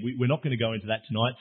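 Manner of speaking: usual speaking voice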